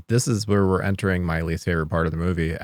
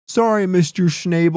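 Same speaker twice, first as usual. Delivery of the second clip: high, loud mock neighborly call; mock holler to a neighbor; 11 semitones higher, 6 dB louder than usual. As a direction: call it over the fence, pitched up. Mock greeting to an imagined neighbor.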